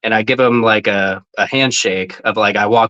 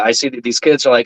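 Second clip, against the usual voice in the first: ordinary delivery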